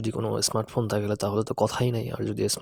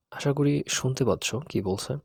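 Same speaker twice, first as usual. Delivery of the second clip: normal talking voice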